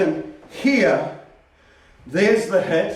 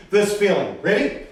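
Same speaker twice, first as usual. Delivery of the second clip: ordinary talk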